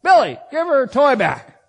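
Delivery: a mother calling across the house; mock parental yell from the kitchen; mom yelling. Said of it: "the mom just yells out".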